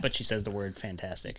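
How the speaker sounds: ordinary talk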